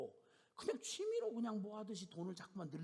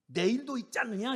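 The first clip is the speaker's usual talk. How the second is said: louder, higher challenge; defiant retort; 8 semitones higher, 11 dB louder than usual. Retold or imitated. Sister challenging the elder, defiant. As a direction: throw it back. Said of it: "이거야".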